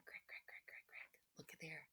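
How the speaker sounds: hushed whispered crack crack. Acted. Teacher whispering the egg cracking to the class, hushed. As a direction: breathe the cracks softly.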